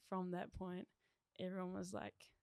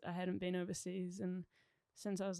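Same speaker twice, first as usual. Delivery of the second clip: ordinary talk, nothing marked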